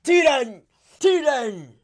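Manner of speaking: high, loud command to fire; full-force battle shout; shouted order. A narrator's reading. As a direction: yell urgently to your men. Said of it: "dirigiéndose a sus hombres gritó".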